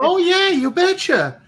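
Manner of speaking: high, loud hammed-up Minnesota "you betcha"; mock Minnesota accent. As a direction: play a cheerful Minnesotan, badly overdone. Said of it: "You didn't say it right".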